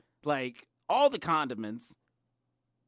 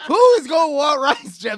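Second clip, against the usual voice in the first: screaming it even louder and higher; louder outraged yell; 9 semitones higher, 16 dB louder than usual. Real, not acted. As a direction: yell it at full volume, appalled. Outraged repeat about rice.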